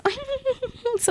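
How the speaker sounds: high, soft mock giggle; imitating her friend's giggling; imitated giggle. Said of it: "angefangen zu giggeln".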